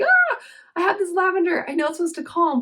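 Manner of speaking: high, loud flustered exclamation; frantic new mom. Retold or imitated. Mom with crying newborn suddenly remembers her lavender, high and flustered. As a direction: blurt it out like a panicked new mom. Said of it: "I'm like".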